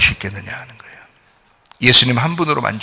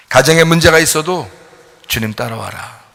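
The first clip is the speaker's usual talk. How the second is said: normal talking voice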